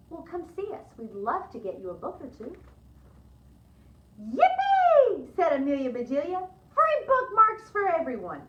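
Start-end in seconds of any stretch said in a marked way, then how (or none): 4.2-8.4 a loud, high whoop of joy; gleeful cheer. Acted. Amelia Bedelia cheers loudly to everyone about free bookmarks. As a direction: shout it out like a party.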